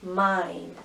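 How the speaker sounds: ordinary delivery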